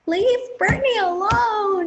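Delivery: high, slow, tearful plea. Retold or imitated. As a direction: beg them to leave her alone. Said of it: "the guy who was like".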